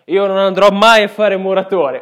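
loud. Retold or imitated. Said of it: "mi dice".

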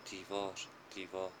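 a dazed father murmuring to himself; fading repeat. Acted.

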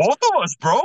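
loud, high, thrilled; excited. Real, not acted. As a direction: blurt it out with excitement. Excited about shared chills.

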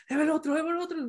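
high, hushed mock excitement; excited moviegoer. Imitating the audience seeing another portal open, hushed excitement. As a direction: softly squeal it like an eager fan.

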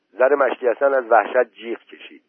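plain reading voice

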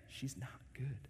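soft confiding aside; confiding to the men quietly; hushed aside. Real, not acted. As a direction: drop it to a murmur.

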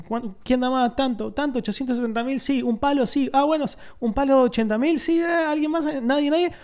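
high-pitched auctioneer patter. Retold or imitated. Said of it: "al chabón que tiene el martillo".

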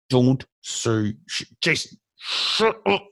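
imitating his wife, slow and warning; a slow, mock hushing wife; wife's voice. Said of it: "it's my wife's voice".

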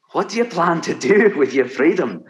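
Scottish Highland lilt, rising high; Highland Scottish accent. Real, not acted. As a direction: speak it like a Highlander. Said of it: "your Highlander accent, spectacular".

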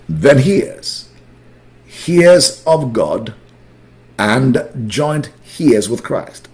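slow, deliberate scripture reading; measured reading with clear pauses between phrases. Real, not acted. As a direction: read it slowly for a class to follow along. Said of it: "Let me slow down".

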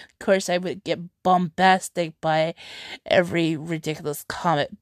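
normal talking voice

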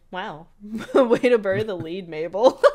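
laughing Dipper. Retold or imitated. Recapping the cartoon to a friend, Dipper teasing Mabel, laughing loudly. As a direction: crack up mid-quote as Dipper. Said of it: "And Dipper was like".

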